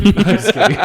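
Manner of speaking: laughing. Real, not acted. Laughing at his own jab.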